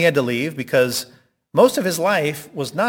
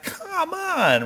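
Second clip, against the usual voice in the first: much higher, fed-up plea; tired of knocking, begging; exasperated high Peter. Retold or imitated. Peter voiced after knocking long.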